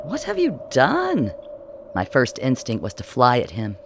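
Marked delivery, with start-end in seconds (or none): 0.0-1.3 high, slow, breathy-sounding mock shock; a playful villain feigning horror; Bennett's voice. A narrator's reading.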